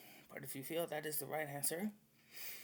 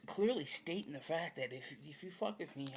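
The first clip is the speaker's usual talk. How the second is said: normal talking voice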